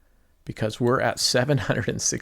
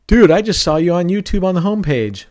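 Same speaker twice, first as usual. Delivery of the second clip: higher, louder, amazed buddy; excited friend; 7 semitones higher, 7 dB louder than usual. Retold or imitated. Friend calling to say he spotted him on YouTube, thrilled. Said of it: "friends calling me going".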